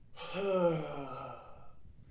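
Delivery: long audible sigh out through the mouth; big sigh. Shown as a demonstration. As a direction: let out a big bored sigh. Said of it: "Just go".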